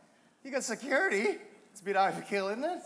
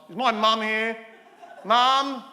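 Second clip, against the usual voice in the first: louder call into the crowd; 6 dB louder, slower than usual. Acted. Comic host calling to his mother in the audience, loud. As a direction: call out for your mum.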